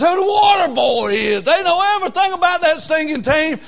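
high, loud, driving preaching; full-force preaching. Acted. Preacher railing at his church about football fans, loud high preaching. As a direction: preach it hard and high to the rafters.

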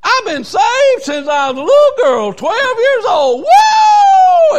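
high, loud crying holler, slowing down; old lady testifying to the church; old lady's shout. Retold or imitated. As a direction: testify at the top of the voice. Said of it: "She said".

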